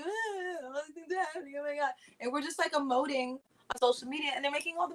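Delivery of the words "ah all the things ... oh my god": mock wail. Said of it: "we're just sad".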